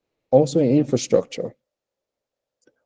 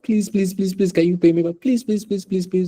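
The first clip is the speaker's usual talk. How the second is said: high, begging please repeated over and over; hammed-up desperate begging to a debtor; mock pleading; 8 semitones higher than usual. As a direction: plead sweetly and pitched way up. Begging market women to repay.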